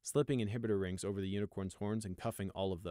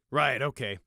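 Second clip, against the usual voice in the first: distracted; 3 semitones higher, 7 dB louder than usual. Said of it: "Bright replied distractedly".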